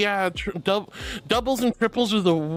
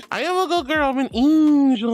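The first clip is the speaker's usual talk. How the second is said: mock good girl; 6 semitones higher, 5 dB louder than usual. Streamer claims to chat she's an angel, sweetly high. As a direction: coo it like a little angel.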